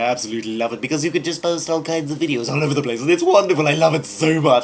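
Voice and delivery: high, loud, over-the-top gushing; gushing mock welcome. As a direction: ham up the love for the site.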